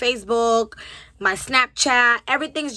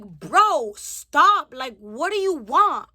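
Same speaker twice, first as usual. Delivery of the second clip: retold fed-up demand, drawn out; slow, emphatic plea to be left alone; exasperated; 2 semitones higher, much slower than usual. Acted confrontation with a follower.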